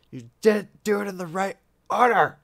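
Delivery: high, loud exasperated complaint; exasperated scolding. Real, not acted. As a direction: scold them like a fed-up boss. Scolding teammates in game.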